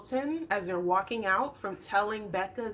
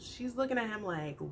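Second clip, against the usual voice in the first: ordinary talk, nothing marked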